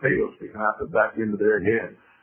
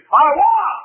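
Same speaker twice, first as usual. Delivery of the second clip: high, loud preacher's yell; shout from the grave; 9 dB louder than usual. Acted. Preacher voices dead gods in the grave, high shout. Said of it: "have to shout from the grave".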